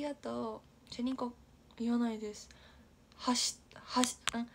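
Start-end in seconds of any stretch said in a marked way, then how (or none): 3.2-4.2 slow voiceless はし, はし; trying out はし pitch accent; pitch accent demo. Shown as a demonstration. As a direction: whisper はし slowly to test the accent. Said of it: "橋と箸".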